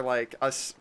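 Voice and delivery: usual speaking voice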